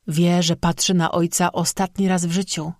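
ordinary delivery, nothing marked

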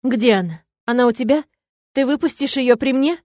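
desperate, close to breaking; tormented soul. Acted. Frantic mother on phone.

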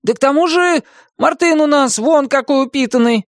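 high-pitched eager argument; excited, squeaky insistence; indignant little hero. A narrator's reading. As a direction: keep ranting in a high character voice. Bartolomeo continues his rant.

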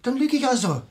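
offended snap; indignant. Acted. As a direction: bristle at being called a liar. Indignant reply to inspector's doubt.

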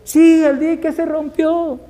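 very high, drawn-out acted cry; imitating a woman crying out as she runs; panicked shouting. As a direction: pass on the alarm in a high, stretched wail. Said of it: "salió corriendo y siguió gritando".

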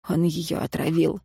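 soft, subdued voice; quiet accusation. A narrator's reading. Ignat accusing his brother of poisoning, quietly. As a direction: make the accusation under the voice.

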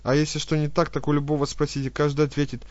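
rapid fawning chatter. Acted. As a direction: gabble it out, swallowing words. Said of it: "Быстро, глотая слова, затараторила".

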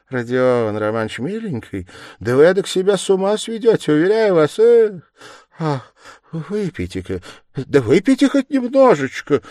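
high, urgent, fussy pleading; coaxing investigator. A narrator's reading. Investigator begging the suspect to drink, coaxing. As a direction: coax anxiously, climbing in pitch and volume.

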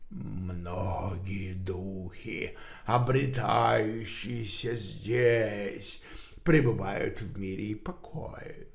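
slow, low hollow otherworldly voice; muffled spirit voice. Acted. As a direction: proclaim it slowly as a distant spirit. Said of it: "глухой голос, исходивший из уст Хок-Шоу".

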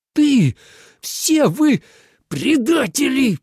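high, loud, enraged accusation; furious growl. A narrator's reading. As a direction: roar at the traitors. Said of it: "прорычал Минлу". (яростно рыча, на высоких нотах)

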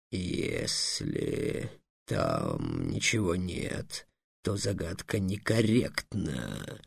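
very low, very slow, soft dragon voice; the dragon drags out a pedantic objection; dragon objecting. A narrator's reading. (очень низкий медленный голос дракона)

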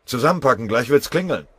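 laut und höher gerufen; lauter Befehl. Acted. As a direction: den Aufbruch energisch befehlen. Motz befiehlt Aufbruch. (Motz ruft den Männern laut den Aufbruch zu)

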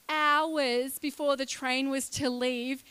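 normal talking voice

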